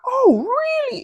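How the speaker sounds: high, loud incredulous cry; shocked. Retold or imitated. To the man who set the rule, shocked high cry. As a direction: throw back an outraged "really?".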